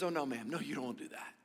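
a low, hushed aside, like an usher steering her away; lightly voiced usher, quietly discouraging; hushed usher warning. Usher warns the elderly lady.

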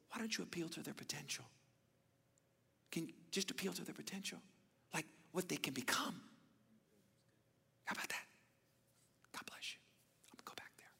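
soft and quick, fading to a whisper; quiet advice. Retold or imitated. Brother quietly advising the coach, fading to a whisper. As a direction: say it gently and trail off to a whisper.

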